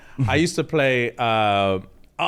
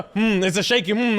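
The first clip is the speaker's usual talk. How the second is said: imitated tense, raised-pitch humming; shaky hum; 6 semitones higher than usual. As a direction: hum like a dad holding himself back. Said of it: "he hums after he shouts".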